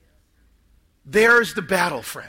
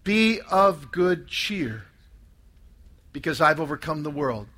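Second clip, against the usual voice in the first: as Jesus; 7 semitones lower, 5 dB quieter than usual. Acted. Jesus calming his frightened disciples, soft and tender. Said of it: "Not me, Jesus".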